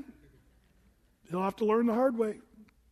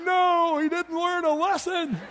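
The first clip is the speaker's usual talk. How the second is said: laughing; 9 semitones higher, 6 dB louder, slower than usual. Real, not acted. Dad laughs at son.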